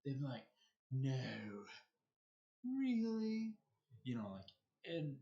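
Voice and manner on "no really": high, loud, exaggerated sarcastic mock surprise; obvious sarcasm. Shown as a demonstration. Showing how most people do sarcasm, obviously sarcastic. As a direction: lay the sarcasm on thick, pitched way up. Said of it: "most people when they're sarcastic".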